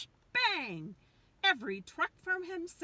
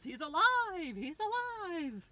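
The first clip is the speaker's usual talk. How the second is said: rising, elated "it's alive" shout; mad scientist cry; 9 semitones higher, 4 dB louder than usual. A narrator's reading. Reading picture book to kids, mad scientist cry. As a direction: crow it like a mad doctor. Frankenstein-style triumphant cry.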